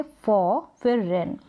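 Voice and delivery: "फॉ, फिर रेन" broken into two parts; syllable split. Shown as a demonstration. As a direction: set "फॉ" and "रेन" apart as separate syllables. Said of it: "इस तरीके से दो parts बनाए".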